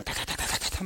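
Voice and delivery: rapid-fire patter. Retold or imitated. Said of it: "말이 얼마나 빠른지 몰라요".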